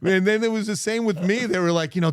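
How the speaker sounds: ordinary talk, nothing marked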